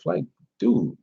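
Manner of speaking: high, soft exasperated "dude". Real, not acted. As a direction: let your disbelief drop out of it. Exasperated reaction.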